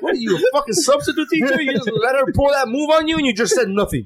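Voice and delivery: high-pitched mock scolding; retold disbelief, voice pitched well up; incredulous. Husband chiding wife for letting the move slide, incredulous.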